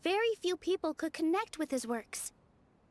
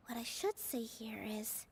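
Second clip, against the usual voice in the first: whisper; 7 semitones lower, 9 dB quieter than usual. Acted. Inner thought, whispered to herself.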